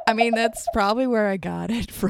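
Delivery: ordinary talk, nothing marked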